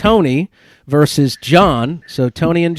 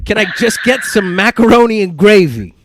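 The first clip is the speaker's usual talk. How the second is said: put-on Italian accent; 5 semitones higher than usual. Host rereads the third quiz line, put-on Italian accent. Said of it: "Sorry, my Italian accent".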